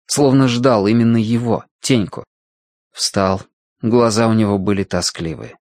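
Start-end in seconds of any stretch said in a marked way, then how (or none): none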